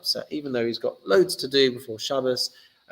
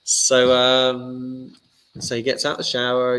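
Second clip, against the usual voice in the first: usual speaking voice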